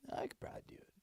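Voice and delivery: soft unvoiced whisper. Real, not acted. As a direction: whisper it to yourself. Whispered to self.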